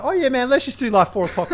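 teasing imitation. Mocking co-host's casual excuses.

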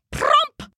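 high, sudden pop; popping sound. A narrator's reading. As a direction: make it burst like a plucked root. Imitated pop of turnip uprooting.

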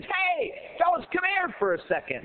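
high, quick call to passers-by. Acted. Frog hailing ducks flying overhead, calling out.

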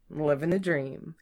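plain reading voice

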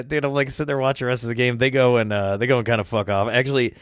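ordinary delivery

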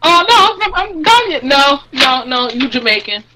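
high, insistent back-and-forth objection; hammed-up arguing; mock protest. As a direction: act out a flustered correction being overruled. Acted argument over a wrestler's origin.

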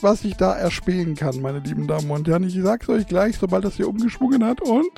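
ordinary delivery

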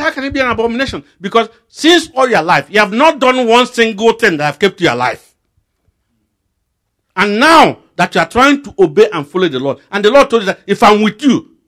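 high, loud rebuke; preacher scolding a doubter, loud and high; fiery preaching. Acted. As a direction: preach it hard, building. Preaching at full force.

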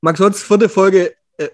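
slow, loud shout-out; Gruß an einen Fan. Real, not acted. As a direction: announce the greeting loudly, word by word. (lauter, langsamer Gruß)

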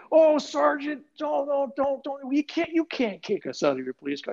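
high, whiny pleading voice; mock begging. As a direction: ham up the frightened begging. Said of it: "they started begging me".

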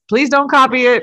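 mock pleading. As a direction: beg them not to copy the strategy. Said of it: "and I'm gonna be like".

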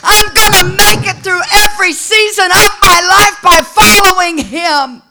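loud, rising preacher's cry over the music; preaching at full force. Acted. Preacher urging the hurting congregation, in a loud preaching cry. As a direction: preach it out, stirring the church.

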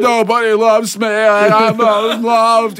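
high, slow wailing self-pity; hammed-up drunk wail. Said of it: "I said".

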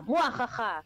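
hammed-up villain cackle; evil cackle. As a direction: cackle like a cartoon witch. Villain laugh after curse.

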